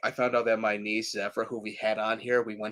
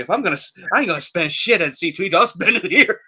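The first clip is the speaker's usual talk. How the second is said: excited; 6 semitones higher, 7 dB louder, faster than usual. Retold or imitated. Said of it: "I'm like".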